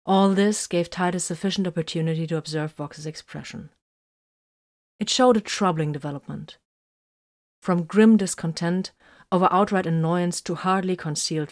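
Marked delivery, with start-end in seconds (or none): none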